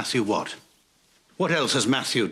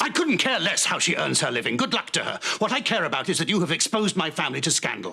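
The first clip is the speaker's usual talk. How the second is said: heated, higher-pitched reprimand; outraged rebuke; 6 semitones higher than usual. Acted. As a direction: rebuke them indignantly over the scandal. Lord rebukes women over scandalous luncheon.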